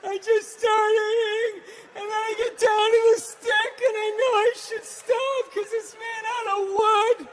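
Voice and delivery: high, loud hammed-up crying voice; exaggerated weepy whine; mock blubbering. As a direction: sob through it like a reality show confession. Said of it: "Blubber in their eyes".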